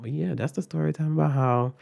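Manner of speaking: ordinary delivery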